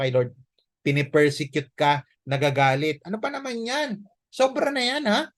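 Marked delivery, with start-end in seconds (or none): 3.1-5.3 higher-pitched scoffing voice of a nagging friend; mocking critics. Friends scoffing at a churchgoer, lightly mocking.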